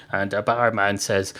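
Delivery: ordinary delivery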